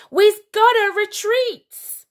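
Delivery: high, loud, slow beast voice; panicked monster shout. A narrator's reading. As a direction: call the retreat in panic. General orders retreat.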